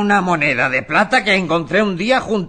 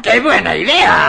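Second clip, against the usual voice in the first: higher, excited cry of approval; delighted exclamation; 5 semitones higher, 4 dB louder than usual. Acted. Father hedgehog hears his child's trick, delighted exclamation. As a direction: burst out delighted at the plan.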